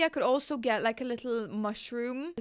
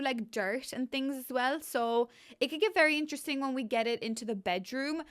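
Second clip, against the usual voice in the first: ordinary talk, nothing marked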